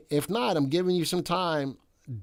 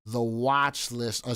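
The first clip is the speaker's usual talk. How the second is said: ordinary talk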